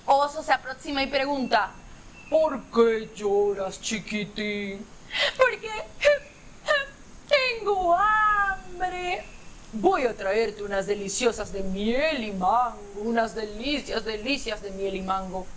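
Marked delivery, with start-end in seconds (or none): 2.3-4.8 low, slow bear voice; deep-voiced bear asking gently. Bear asks the baby. 5.4-9.2 high, very slow teary whine; whimpering hungry baby elephant; crying baby elephant. Acted. 9.7-15.5 bear voice. Acted. Bear offers honey treats.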